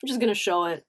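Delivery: usual speaking voice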